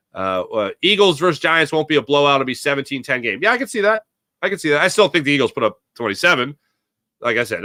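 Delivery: ordinary talk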